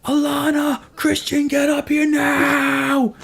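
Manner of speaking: high-pitched, drawn-out parental shout; retold furious yell upstairs; snapped dad yelling. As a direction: holler for the kids like a dad who has just snapped. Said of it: "Something in my head snapped".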